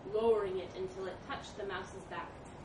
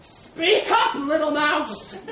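loud, high booming order; domineering elephant; 5 semitones higher, 13 dB louder, much slower than usual. Acted. Elephant taunting the trapped mouse, loud and high. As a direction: bellow down at someone small.

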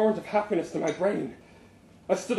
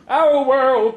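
loud, high crowd answer; shouted; 6 semitones higher, 9 dB louder than usual. A narrator's reading. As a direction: shout it back as one crowd. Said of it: "they shouted".

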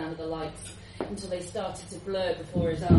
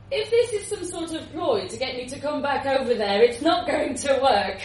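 defiant; 5 semitones higher, 7 dB louder than usual. Acted. John suspects a ploy.

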